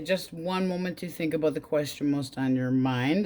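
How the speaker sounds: ordinary talk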